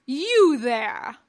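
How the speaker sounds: loud, sudden challenge; commanding hail. A narrator's reading. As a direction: call out sharply to stop a stranger. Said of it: "C'baoth hailed".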